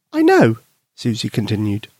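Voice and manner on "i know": put-on squeaky high child voice; a little girl owning up. Susie admitting to her friend, high girl voice.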